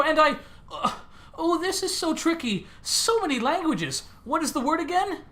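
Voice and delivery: high, flustered protocol droid; a high, fussy droid fumbling for words. Acted. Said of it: "I can do it better".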